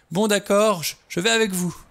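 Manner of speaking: high, loud whimpering surrender; distraught wail. A narrator's reading. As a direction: give in, wailing in utter dismay. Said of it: "gémit-elle au comble du désarroi".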